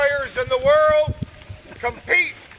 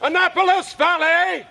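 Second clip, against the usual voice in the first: town crier; 4 semitones higher than usual. Acted. Crier performing at the competition.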